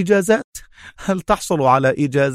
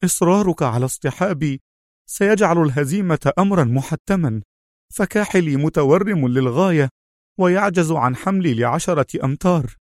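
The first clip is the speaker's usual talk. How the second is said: higher-pitched, pained protest; bitter Khaled; 6 semitones higher than usual. A narrator's reading. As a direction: argue bitterly in a raised pitch. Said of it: "ابتسامة مريرة وهو يقول".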